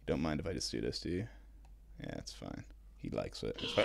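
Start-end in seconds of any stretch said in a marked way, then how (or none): none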